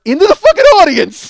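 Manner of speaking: shouted punchline breaking into laughter; laughing shout. Real, not acted. Comic telling friends of the dart disaster, laughing shout. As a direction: yell it high and loud, cracking up.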